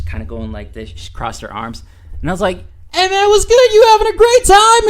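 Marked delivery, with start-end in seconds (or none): 2.9-4.9 high, loud bar-side shout; shouted greeting. Retold or imitated. Man hailing a stranger at the bar, loud and high. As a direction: imitate calling out over a noisy bar.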